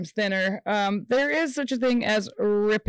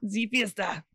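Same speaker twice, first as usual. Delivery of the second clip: put-on quiet, dominant German accent; 4 dB quieter than usual. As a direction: say it like a powerful German host. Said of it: "I give everybody a German accent".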